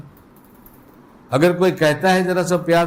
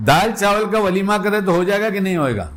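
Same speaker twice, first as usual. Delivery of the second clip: ordinary talk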